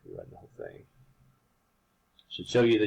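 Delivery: stumbling over the words; restarting the sentence mid-stutter. Real, not acted. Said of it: "I don't know why I was stuttering".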